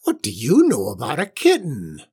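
scornful witch voice with big pitch swoops; snorting witch. A narrator's reading. Witch grilling her cat about the kitten, scornful. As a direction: demand it as a suspicious old witch. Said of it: "snorted the witch".

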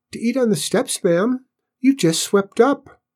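high, polite little voice; small squeaky Cinders. A narrator's reading. Cinders explains himself to cook, small high voice.

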